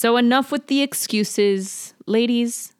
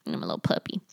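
deep, soft puppy voice for the dog; cartoon dog; 14 semitones lower, 7 dB quieter, much faster than usual. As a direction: speak for the dog, low and quiet.

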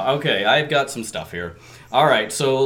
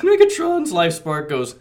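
ordinary talk, nothing marked

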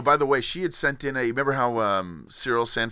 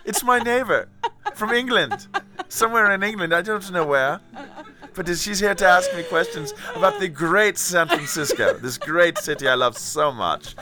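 a light put-on English accent; playing a posh Englishman called Tony; 4 semitones higher than usual. Guest interviewed on the show in a light English accent. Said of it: "I've got my stride now with this accent".

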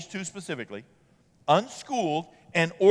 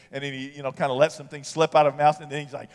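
ordinary talk, nothing marked

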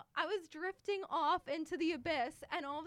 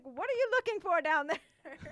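louder, higher teasing call; mock suspicious; 5 semitones higher, 9 dB louder than usual. Laughing at the diver circling beneath her, loud high teasing call. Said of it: "I'm like".

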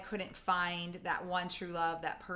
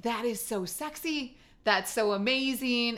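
excited gush; 5 semitones higher, 6 dB louder than usual. Retold or imitated. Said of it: "And I was like".

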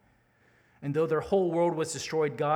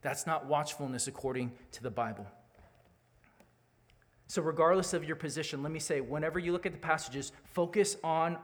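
ordinary delivery

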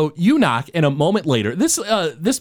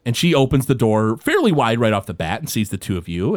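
ordinary talk

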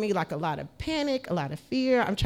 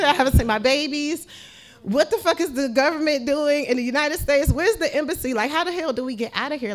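high, louder fed-up rant; desperate rant; 7 semitones higher, 4 dB louder than usual. Retold or imitated. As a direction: vent frustration to the world. Retold desperate outburst.

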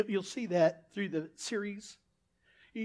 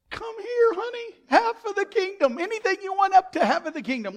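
very high, loud, hammed-up invitation; doting king impression; 10 semitones higher, 5 dB louder than usual. King welcoming Esther, high hammed-up doting voice. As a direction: gush the offer as a smitten king. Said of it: "and the king goes".

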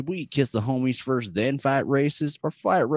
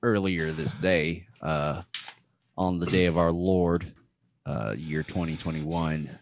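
put-on Texas accent; 8 semitones lower than usual. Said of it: "let me get back to my Texas accent".

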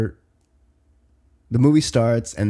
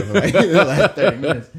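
louder, laughing as it spills out; laughing through words; 5 semitones higher, 6 dB louder than usual. Real, not acted. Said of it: "laughing while telling".

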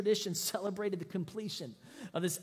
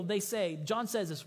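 ordinary delivery

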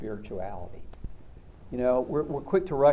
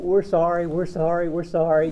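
loud, higher pleading crowd; grovelling apology; 5 semitones higher, 7 dB louder than usual. Retold or imitated. Bowing crowd begs God, loud and higher. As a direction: cry it out like terrified worshippers bowing.

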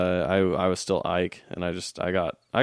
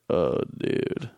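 drawn-out, slow-talking mimicry; slower talker imitation; 3 semitones lower than usual. Comparing two friends' similar voices, the slower drawn-out version. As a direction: stretch OH and DUDE out lazily. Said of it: "He talks slower than me".

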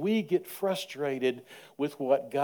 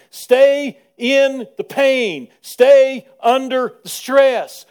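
full-force preaching; 9 semitones higher, 10 dB louder than usual. Acted. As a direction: hammer each phrase from the pulpit. Preaching, stretching key words.

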